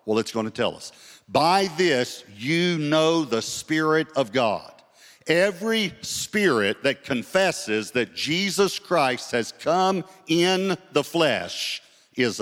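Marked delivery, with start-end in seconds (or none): 1.3-12.4 slow, high, ringing verse delivery; emphatic scripture reading. Real, not acted. Preacher showing how to know God's Spirit, slow ringing scripture reading. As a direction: proclaim the verse slowly, pitched up, word by word.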